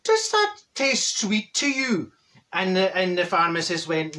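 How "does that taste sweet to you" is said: high, slow stage-Irish question; drawn-out, lilting Irishman's voice; Irish accent. Acted. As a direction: ask it slowly in a mock Irish accent. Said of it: "And Paddy".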